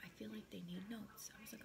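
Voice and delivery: whispering. Real, not acted. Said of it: "I have to whisper".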